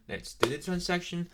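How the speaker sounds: ordinary delivery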